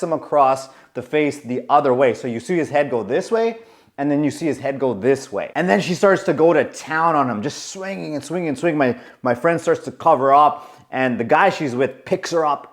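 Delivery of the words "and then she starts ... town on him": usual speaking voice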